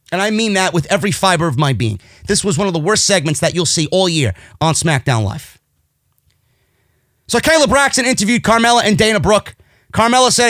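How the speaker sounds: ordinary delivery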